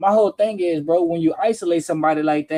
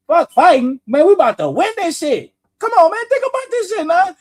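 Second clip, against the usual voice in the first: loud high defiant voicing of an innocent man; high, loud defiant outburst; confident innocent man; 9 semitones higher, 6 dB louder than usual. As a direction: belt it like someone sure they'll win. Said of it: "Ain't nobody gonna sit back and be like".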